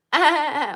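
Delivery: high mock laughing; imitated laugh. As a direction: mimic someone laughing off a warning. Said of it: "Like this, he's laughing".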